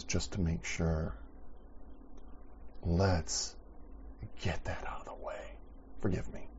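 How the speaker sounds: hushed, unvoiced forgive me; almost breathless prayer, barely voiced; whispered plea. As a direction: whisper the request to God. David asking forgiveness.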